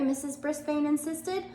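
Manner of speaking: ordinary delivery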